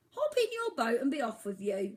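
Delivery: high, loud dismissive bird; brusque albatross. Acted. Albatross sending Oliver away, high brusque voice. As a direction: shoo him off.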